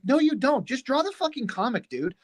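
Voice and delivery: high-pitched retold scolding; mock-exasperated, without raising the volume; exasperated retort. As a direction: plead with a buddy, pitched right up.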